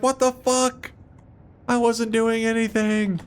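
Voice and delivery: high, put-on whiny protest; high mock voice, slowed, aggrieved; indignant healer. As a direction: squeak out innocent outrage. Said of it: "Healer's like".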